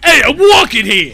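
put-on high, loud New York Italian accent. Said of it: "Italian lobster".

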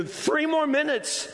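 high, slow shout of the countdown; preacher shouting time's running out; shouted warning. Retold or imitated. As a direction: yell it right at them. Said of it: "just to shout in your direction".

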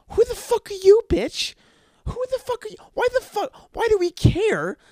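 high yelling rant; angry shouting. Real, not acted. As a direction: shout it, outraged. Ranting about a teen's opinion.

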